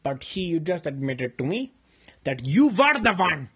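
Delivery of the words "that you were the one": a blow-up over the butter chicken; a shouting match over stolen food; angry shouting. Real, not acted. Shouting accusation and fight.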